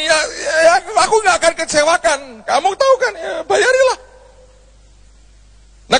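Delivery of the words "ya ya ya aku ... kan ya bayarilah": high, quick and eager voice of God; God cheerfully urging him to pay; playful God. Retold or imitated. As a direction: let God answer brightly and fast. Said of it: "dia mau berkata begini".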